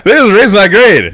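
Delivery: high, loud disbelief; incredulous outburst. Real, not acted. Podcast guest telling host about his teachers, loud incredulous outburst. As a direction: blurt it out loudly, high-pitched, amazed. Laughing in disbelief at own story.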